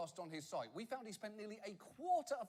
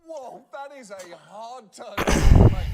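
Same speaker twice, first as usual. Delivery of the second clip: loud, slow amazed reaction; floored by the sudden sales pitch; stunned whoa; 4 semitones higher, 25 dB louder, much slower than usual. Real, not acted. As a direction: react like whiplash. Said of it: "reaction to clip".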